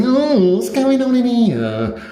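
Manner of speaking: high, loud, slow camp comic drawl; a hammed-up camp carry-on comic, outraged; shrill mock-scandalised shriek; Kenneth Williams. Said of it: "being like Kenneth Williams".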